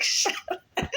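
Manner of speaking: voiceless laughed-out mock protest; laughing retort. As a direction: brush off the teasing through laughter. Laughing retort to teasing brother.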